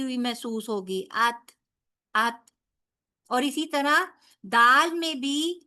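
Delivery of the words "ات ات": "ات" with a light puff of air after ta; ta with breath. Shown as a demonstration. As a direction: show the air escaping on "ات". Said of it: "ہلکی سی ایئر آپ کو باہر آتی".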